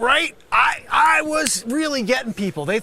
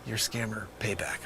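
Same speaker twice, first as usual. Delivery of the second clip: shocked whisper; 12 semitones lower, 12 dB quieter than usual. Acted. Realizes he's been caught.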